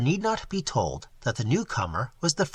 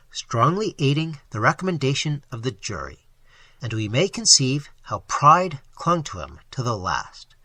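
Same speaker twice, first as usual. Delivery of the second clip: plain reading voice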